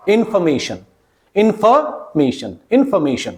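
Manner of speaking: information as in-fuh-MAY-shun; weak FOR syllable. Shown as a demonstration. Teacher giving the correct form, information with weak fuh. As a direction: reduce the middle of information to fuh. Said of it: "it is information".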